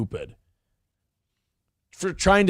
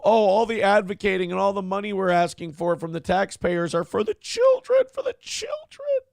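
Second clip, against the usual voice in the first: mocking; 3 semitones higher than usual. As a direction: sneer at the fake concern. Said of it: "I don't buy that".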